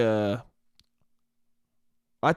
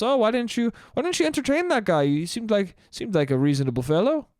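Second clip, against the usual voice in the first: high, lilting mock Irish accent; hammed-up Irish lilt, rising at the end; 6 semitones higher than usual. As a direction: play an Irish bartender with swooping pitch. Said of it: "that's an Irish accent".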